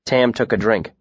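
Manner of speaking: plain reading voice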